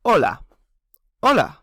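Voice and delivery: "Hola" spoken clearly for learners to repeat; Spanish hola. Shown as a demonstration. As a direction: say "Hola" with a silent h, OH-la. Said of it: "We've said".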